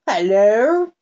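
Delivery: a hint of an imp calling at the window; creature voice. A narrator's reading. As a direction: greet her as a cheeky night creature. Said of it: "said the creature".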